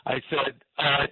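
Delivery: usual speaking voice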